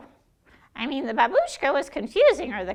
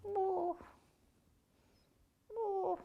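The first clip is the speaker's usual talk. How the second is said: soft boo; 5 semitones higher, 15 dB quieter, much slower than usual. Acted. Quiet booing.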